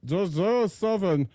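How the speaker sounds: a lightly voiced lunk musing aloud; dumb henchman. Shown as a demonstration. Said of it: "he's just talking like this".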